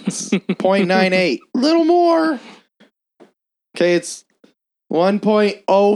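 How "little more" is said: high nagging voice; mock picky old lady. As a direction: nag like a fussy customer. Picky customer retold.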